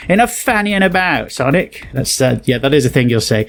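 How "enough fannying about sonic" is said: lightly voiced British scold; a light British Knuckles telling Sonic off. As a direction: tick Sonic off, British style. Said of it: "meme approved version of Knuckles saying".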